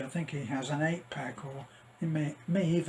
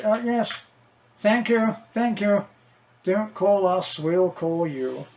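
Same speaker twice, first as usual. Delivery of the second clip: higher, louder, falsely gracious dismissal; audition panel brush-off; 4 semitones higher, 6 dB louder than usual. Pretend. Casting panel dismissing a hopeful actor, mock polite and loud. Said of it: "what they do on auditions".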